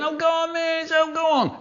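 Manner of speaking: very high pleading whine; whiny kid. Acted. Whiny kid begging the teacher, whining. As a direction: wheedle like a child begging. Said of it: "The whiny ones".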